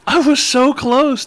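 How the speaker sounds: high, slow hammed-up wail; comic whining lament; mock anguish. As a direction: drag it out high in fake despair. Lamenting the missed birthday holiday.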